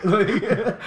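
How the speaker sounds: laughing. Real, not acted. Laughs at his own brag.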